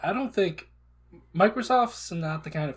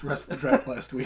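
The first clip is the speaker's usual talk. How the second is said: ordinary talk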